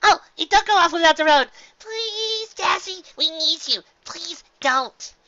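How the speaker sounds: very high, loud begging voice; pleading Pinkie. Acted. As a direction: beg Dashie to stay.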